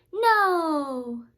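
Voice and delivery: loud, high, final no; firm refusal. Acted. Max firmly refuses the wild things. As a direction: slam the door on it. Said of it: "And Max said".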